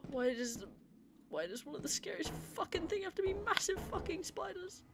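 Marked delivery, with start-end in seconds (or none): 1.3-4.8 frightened wail. Real, not acted. Spider horror rant.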